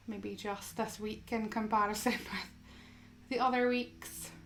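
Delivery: soft, unhurried yoga guidance with long pauses; guided meditation. Acted. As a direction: gently guide them into stillness, phrase by phrase.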